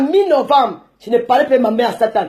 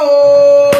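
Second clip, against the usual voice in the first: loud cry out; 7 dB louder than usual. Real, not acted. Crying out to Biloa.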